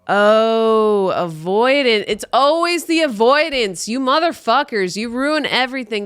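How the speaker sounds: loud, high, exasperated rant at avoidant people; loud outburst. Real, not acted. Ranting at avoidant partners, loud and exasperated. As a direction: yell it out, fed up and mock-furious.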